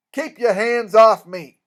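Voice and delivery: loud, slow, forceful order; indignant rebuff. Acted. Doctor rejecting the King's hand, angry. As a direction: refuse the handshake with open contempt.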